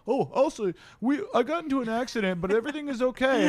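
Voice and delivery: mock cheerful. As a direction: sing it out like it's no big deal. Mocking having to report the accident.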